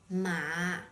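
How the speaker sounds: MA low, dipping; third tone. Shown as a demonstration. Teacher introduces the third tone, MA low. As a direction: drop MA to a low pitch. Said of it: "with a low tone".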